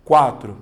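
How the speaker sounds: Quatro said loudly with the W clear, KWA-tro; emphatic answer. Shown as a demonstration. Teacher confirming the right answer to the class, loud. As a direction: punch out Quatro as the final answer. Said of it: "você fala catro ou quatro".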